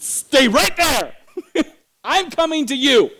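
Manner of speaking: high, loud shout across the street; yelling. Retold or imitated. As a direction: shout urgent orders to your child. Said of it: "And I yelled".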